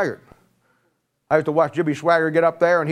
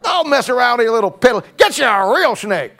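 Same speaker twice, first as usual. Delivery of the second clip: mock dare; 8 semitones higher, 5 dB louder than usual. Mock challenge to snake handlers.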